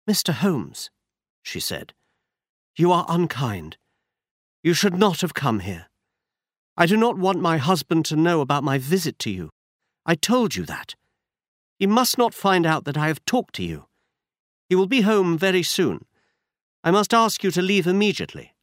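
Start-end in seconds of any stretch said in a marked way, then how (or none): 0.1-0.9 lightly higher voice for an anxious lady; a hint of a frightened noblewoman; Lady Hilda voiced. A narrator's reading. Lady Hilda pleads with Holmes to leave, lightly higher voice. 2.7-18.5 lightly higher voice for an anxious lady; a hint of a frightened noblewoman; Lady Hilda voiced. A narrator's reading. Lady Hilda pleads with Holmes to leave, lightly higher voice.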